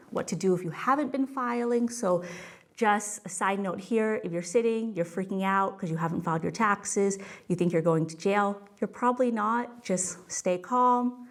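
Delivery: normal talking voice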